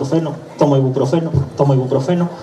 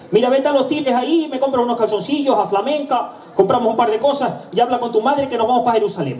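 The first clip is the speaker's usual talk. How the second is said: marido entusiasmado; 8 semitones higher than usual. Acted. Hombre llama a su mujer.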